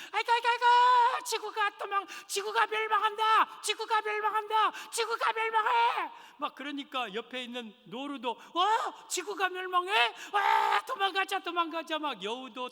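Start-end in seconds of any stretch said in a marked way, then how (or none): 0.1-5.7 very high, loud squealing alarm; panicked rabbit. Acted. Rabbit waking to a thud, shrieking that the earth is ending. 8.3-12.0 high, loud alarmed animal voice; gasp, then urge everyone to run; startled deer. Acted. Deer hearing the rabbit, alarmed high cry to flee.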